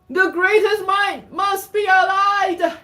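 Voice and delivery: high, loud, drawn-out shout; rallying cry. Acted. Rallying cry in story verse.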